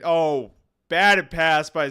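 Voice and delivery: loud, drawn-out burst of excitement; real excited play call; excited shout. Real, not acted. Cheering a big defensive play.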